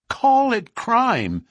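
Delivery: high, loud, slow outburst; passionate cry. A narrator's reading. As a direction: throw the words back, aghast and impassioned. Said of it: "his voice vibrating with passion".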